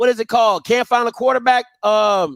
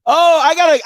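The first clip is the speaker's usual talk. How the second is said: ordinary delivery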